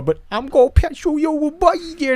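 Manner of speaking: high cooing voice for a puppy; baby voice to a puppy; puppy talk. Retold or imitated. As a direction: coo at the little dog. Talking to puppy.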